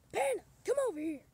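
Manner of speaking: high toy voice. Acted. Toy character voice calling.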